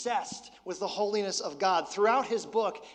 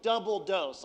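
ordinary talk, nothing marked

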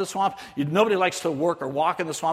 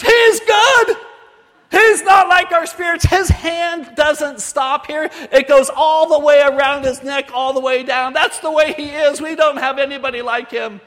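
high, loud villagers' exultation; excited shout; 9 semitones higher, 10 dB louder than usual. Retold or imitated. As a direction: shout it out, thrilled and amazed. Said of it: "we had them shout out".

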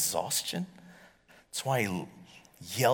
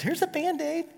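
much higher, thin mimicking voice; imitating Alana. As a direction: squeak it out like a friend's take. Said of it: "like Alana just did".

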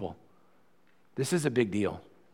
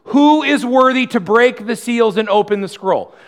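higher and much louder, a herald's cry; loud proclamation; 9 semitones higher, 10 dB louder than usual. Acted. Angel proclaiming to all creation, loud herald cry. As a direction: call it out like a mighty angel across heaven. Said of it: "proclaiming in a loud voice".

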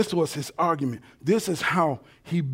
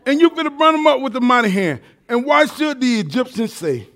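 buttering up; 7 semitones higher, 3 dB louder than usual. Retold or imitated. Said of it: "he's kinda buttering the Lord up".

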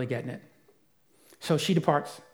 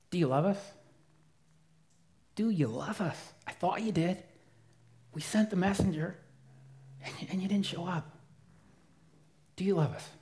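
softer, hurt questioning; quietly wounded, not accusing; brokenhearted; 2 semitones higher, 5 dB quieter than usual. Retold or imitated. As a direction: ask it like a grieving sister. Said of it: "I think she's just brokenhearted".

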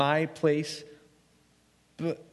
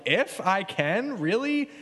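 slow "If I can" then a sharply rising "really?"; sassy; 4 semitones higher, slower than usual. Retold or imitated. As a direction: throw the words back with sass. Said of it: "really sassy gif".

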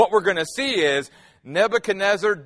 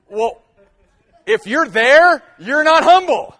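emphatic punchline; 6 semitones higher, 7 dB louder than usual. Real, not acted. Preacher punchline.

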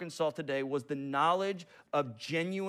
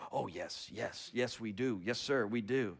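soft, much lower mock eager agreement; eager students; 9 semitones lower, 9 dB quieter than usual. Students answering their professor, meek eager yes. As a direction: play the students gushing yes.

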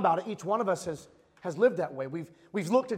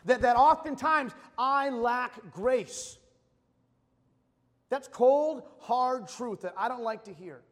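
full force preaching; 3 semitones higher, 5 dB louder than usual. Acted.

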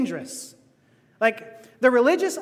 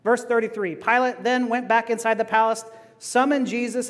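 usual speaking voice

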